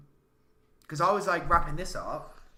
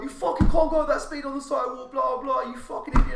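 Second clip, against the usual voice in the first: loud, high mock yell of an angry old man; 10 semitones higher, 10 dB louder than usual. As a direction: hammer it out like the old man did. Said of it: "And he was like".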